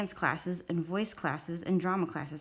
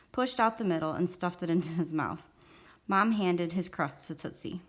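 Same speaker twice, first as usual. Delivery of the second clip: ordinary delivery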